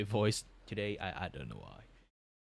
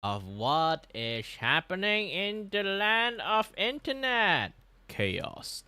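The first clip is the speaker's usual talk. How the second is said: high, slow, stretched-out announcer delivery; showy segment intro; 10 semitones higher, 5 dB louder, much slower than usual. Acted. Streamer introducing internet chaos to viewers, high slow hammed-up announcing. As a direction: draw it out like a grand presenter.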